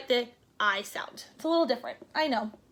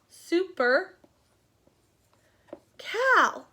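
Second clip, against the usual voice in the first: ordinary talk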